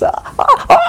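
quick high unvoiced nervous gasps; panting like a nervous fan waiting his turn. Fan waiting for [name], mock nervous gasping. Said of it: "Ese nerviosismo que dices".

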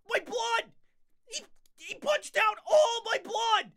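high, slow hammed-up wail; mock wailing. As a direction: wail over the lost blood. Mock wailing over blood.

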